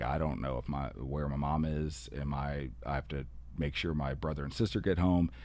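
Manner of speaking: quietly voicing a kid who is overwhelmed; troubled student. Student's worries retold.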